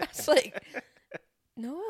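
loud outraged burst; indignant exclaim. Retold or imitated. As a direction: blurt it in disbelief. Outraged reaction in the story.

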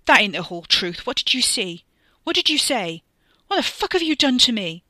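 loud, rising barrage of questions; furious, escalating shout; angry yelling. A narrator's reading. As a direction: yell desperately over the din. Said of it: "Wes yelled, and his voice whirled away".

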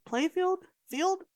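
high, puzzled rise on "Play field?"; catching a wrong word mid-sentence; questioning self-check. Real, not acted. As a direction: ask "Play field?" as if doubting your own words. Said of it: "On the field".

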